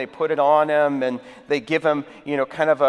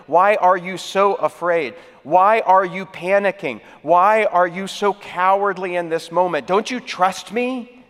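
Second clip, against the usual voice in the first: raised, emphatic pleading questions; impassioned rebuke; 4 semitones higher, 4 dB louder than usual. Acted. Jesus challenges his panicking disciples, impassioned rebuke.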